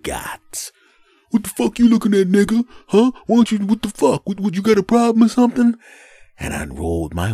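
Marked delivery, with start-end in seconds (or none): 1.3-5.8 high, loud belligerent yelling; high-pitched confrontational rant; cursing driver. Retold or imitated. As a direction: imitate an angry driver picking a fight. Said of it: "begins cursing at me".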